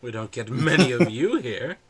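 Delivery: gracious clergyman welcoming a stranger; smiling priest. Acted. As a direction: greet a newcomer with big swoops in pitch. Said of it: "He's like".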